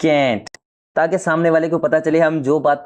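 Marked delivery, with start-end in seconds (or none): 0.1-0.6 "can't" said with firm pressure; stressed can't. Shown as a demonstration. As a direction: lean hard on "can't". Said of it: "इसको हम ज्यादा जोर देंगे".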